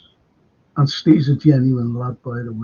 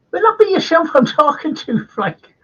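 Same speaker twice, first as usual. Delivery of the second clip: very high mock-Irish voice; Irish accent; 14 semitones higher, 4 dB louder than usual. Irish lad approaching Frank, imitated high Irish accent. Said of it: "I met an Irish lad".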